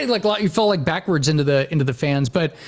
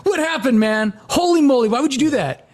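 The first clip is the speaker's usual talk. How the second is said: shocked; 6 semitones higher than usual. Retold or imitated. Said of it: "you're like".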